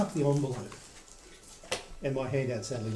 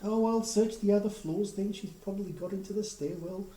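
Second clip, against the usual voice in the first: high-pitched neighbour voice; neighbour character; 6 semitones higher than usual. Acted. Francis McDonald gives up on this floor, high neighbour voice. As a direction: keep the neighbour's raised pitch, settling as she goes. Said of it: "And she wanders off".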